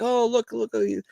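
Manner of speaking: high, cooing mock voice; lightly voiced partygoers; party guests voice.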